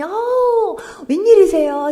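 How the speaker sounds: high, loud delighted gasp of welcome; surprised welcome. Retold or imitated. Deaconess opening the door to the preacher, surprised and loud. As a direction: greet the visitor with a cry of surprise.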